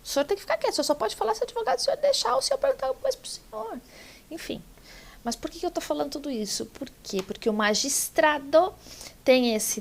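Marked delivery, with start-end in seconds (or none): 0.0-3.8 high, fast mock judge telling a man off; a judge's rapid, high scolding of the husband; imitating the judge scolding. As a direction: rattle it off high and quick like a judge reprimanding. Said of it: "ela virou pra ele e disse assim".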